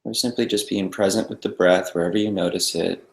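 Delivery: soft, calm meditation guide with long pauses; guided meditation. Real, not acted. Meditation teacher settling a group into the breath, calm guiding voice. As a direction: ease them into stillness, leaving silence between phrases.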